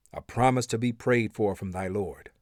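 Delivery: ordinary talk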